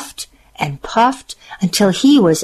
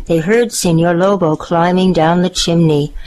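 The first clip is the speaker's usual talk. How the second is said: ordinary delivery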